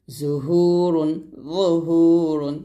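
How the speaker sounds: জুহুরুন very slow with a thick জ; slow heavy za. Shown as a demonstration. Teacher demonstrating heavy জ slowly. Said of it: "জ পেশ জ মোটা করে".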